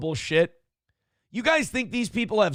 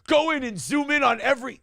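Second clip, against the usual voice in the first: higher, eager online troll voice; internet person impression; 6 semitones higher than usual. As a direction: push the demand like a know-it-all commenter. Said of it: "impression of an internet person".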